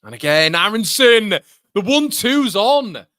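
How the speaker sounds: loud, high, thrilled yell; excited shout. Real, not acted. Fan watching Aronson's one-two, roaring with excitement. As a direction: leap up and roar the move. Fan erupts at attacking move.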